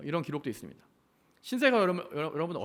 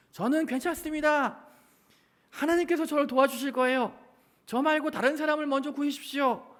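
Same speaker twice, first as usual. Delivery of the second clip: pastor waving off the helicopter; 9 semitones higher, 3 dB louder than usual. Acted. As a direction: wave them away, calmly sure, pitched far up. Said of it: "손을 저으면서 이렇게 말했습니다".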